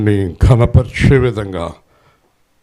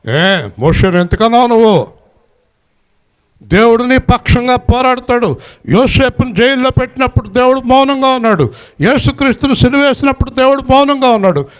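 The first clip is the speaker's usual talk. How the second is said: much higher preaching cry, swelling at the climax; full-force preaching; 12 semitones higher than usual. Acted. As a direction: lift the whole hall with a high call. (ఉచ్ఛస్వరంలో ఉత్సాహ ప్రసంగం)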